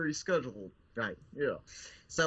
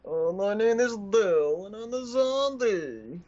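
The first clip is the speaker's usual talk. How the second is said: higher, louder, slow whiny zombie; 7 semitones higher, 6 dB louder, much slower than usual. Acted. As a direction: drawl it like a sulky zombie. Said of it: "Introduce yourself".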